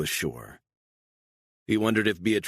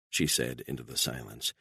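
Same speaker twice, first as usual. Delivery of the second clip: ordinary delivery, nothing marked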